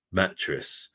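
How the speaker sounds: normal talking voice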